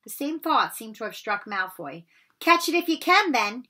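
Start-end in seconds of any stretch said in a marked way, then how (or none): 2.4-3.7 higher, loud taunting shout. Acted. Malfoy shouts a dare as he throws the ball. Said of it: "he shouted".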